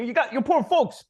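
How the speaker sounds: lecturing the hero about his parents, high mock voice; Lundgren speech impression. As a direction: ham it up like the unhinged sergeant. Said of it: "you know".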